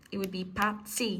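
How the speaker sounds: "pazzi" said with a final I sound; correcting "pazzo" to "pazzi"; correct Italian plural. Shown as a demonstration. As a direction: end "pazzi" on a clear Italian I. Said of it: "It'd be an I, not an O".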